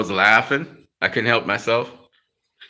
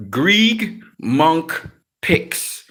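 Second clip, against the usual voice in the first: spelled-out slow; 4 semitones higher, much slower than usual. Shown as a demonstration. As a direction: say Greg Monk Picks very slowly, word by word. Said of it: "you have to type in".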